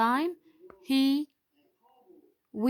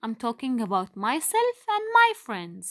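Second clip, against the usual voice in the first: ordinary delivery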